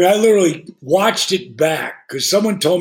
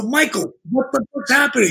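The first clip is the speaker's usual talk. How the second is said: alarmed question; 6 semitones higher than usual. Retold or imitated. Alarmed questions to his friend.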